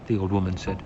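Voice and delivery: ordinary delivery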